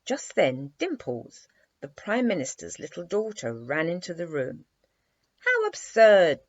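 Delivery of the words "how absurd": loud, high, drawn-out cry of disbelief; cried out. A narrator's reading. Dimples scoffing at the prince's palace, loud high cry. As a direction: cry it out, scandalised. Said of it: "she cried".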